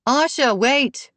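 high, slow call across the lot. A narrator's reading. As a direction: call after her to wait. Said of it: "she called".

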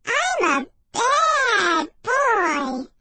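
put-on high, very slow baby voice; squeaky babyish dummy voice; shrill baby voice. A narrator's reading. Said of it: "the dummy said".

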